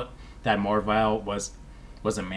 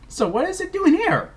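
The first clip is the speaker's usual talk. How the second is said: baffled. Real, not acted. Puzzled by plot hole.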